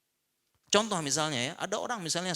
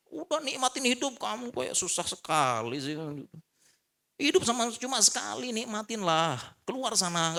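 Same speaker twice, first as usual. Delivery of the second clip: dismissive scoffing; 9 semitones higher than usual. Retold or imitated. Menirukan orang yang meremehkan.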